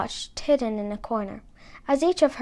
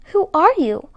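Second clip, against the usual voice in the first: high, louder startled question; 6 semitones higher, 6 dB louder than usual. A narrator's reading. Cinderella startled by the fairy appearing in light. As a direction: gasp it out in sudden surprise. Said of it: "asked a very startled Cinderella".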